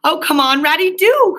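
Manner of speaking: urgent pleading cry. A narrator's reading. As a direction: call after him, pitch way up. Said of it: "cried the Mole".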